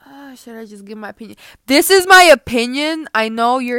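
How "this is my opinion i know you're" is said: louder, hammering the word my; loud declaration. Real, not acted. To her listeners, a loud shout of her opinion. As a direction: shout it so every ear rings. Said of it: "I'm sorry if I'm hurting your ears".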